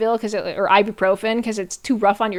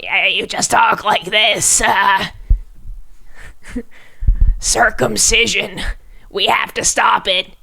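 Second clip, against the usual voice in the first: slow, strained imitation of RFK Jr; RFK Jr. impression; slower than usual. Streamer imitating RFK Jr. for chat, slow and strained. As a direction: mimic RFK Jr.'s halting voice, slowly. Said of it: "That was R F K".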